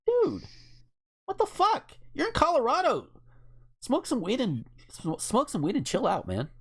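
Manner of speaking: high incredulous plea to chill; exasperated dude. Retold or imitated. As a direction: talk him down like a stoner buddy, pitched high. Exasperated retort to shooter.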